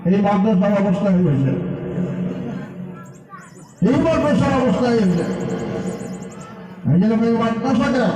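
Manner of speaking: ordinary delivery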